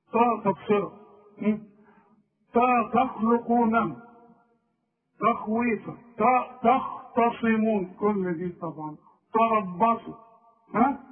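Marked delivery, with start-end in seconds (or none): 2.5-7.9 تا تخلقون ببطء وتاء مفخمة; مد التاء ثقيلة كالطاء; slow heavy ta demo. Shown as a demonstration. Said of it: "تقول على الت الرقيقة طا". (تاء مفخمة خاطئة ببطء)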